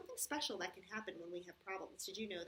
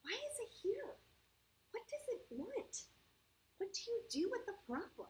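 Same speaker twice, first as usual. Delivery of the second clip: put-on a puzzled little boy asking aloud; anxious child; 12 semitones higher than usual. The boy in the story wonders about his problem, high and anxious. Worried boy's questions in story.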